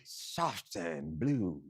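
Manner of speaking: put-on slow, low, soft French accent. Contestant offering a cat name, slow French accent. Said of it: "Was that a French accent".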